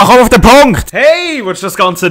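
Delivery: loud high fed-up yell; impatient shout. Real, not acted. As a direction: yell at the dialogue to hurry.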